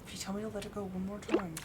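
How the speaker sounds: quiet, fast mock warning; hushed exasperated threat. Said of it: "I'm just like".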